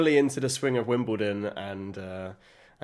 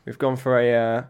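normal talking voice

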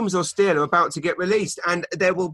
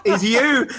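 laughing; 8 semitones higher, 4 dB louder than usual. Real, not acted. Laughs off own joke.

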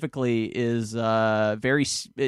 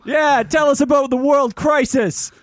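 high, slow heckle from the crowd; heckler's shout; 11 semitones higher, 8 dB louder than usual. Acted. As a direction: yell a demand at the comic.

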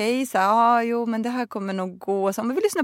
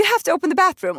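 higher, pleading repeat; desperate plea; 8 semitones higher, faster than usual. Retold or imitated. Passenger repeating her plea to the attendant, higher and pleading. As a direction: plead again for the toilet. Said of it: "för att jag nästan gråter".

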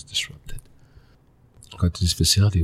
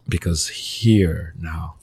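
ordinary talk

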